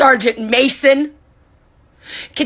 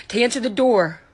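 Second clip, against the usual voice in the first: ordinary delivery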